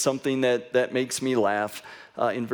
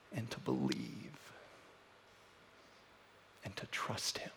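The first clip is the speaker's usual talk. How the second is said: hushed preaching; 2 semitones lower, 8 dB quieter than usual. Real, not acted. Preacher gently urging listeners to trust through confusion, hushed. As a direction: fade phrase by phrase to near whisper. Solemn reflection to congregation.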